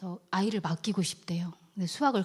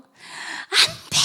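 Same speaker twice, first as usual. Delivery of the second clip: very high, drawn-out shriek; piercing, stretched-out imitation yell; child's scream; 4 dB louder, much slower than usual. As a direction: scream a refusal like an upset child. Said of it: "소리를 지르는데".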